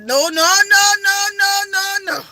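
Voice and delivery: loud, high repeated no, no; emphatic no. Retold or imitated. Insisting to the crying woman, loud repeated no. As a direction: cut her off with a rising insistent no. Urgently refusing her explanation.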